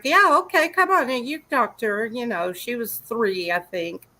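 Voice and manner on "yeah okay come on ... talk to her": mock breezy welcome. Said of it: "I was like".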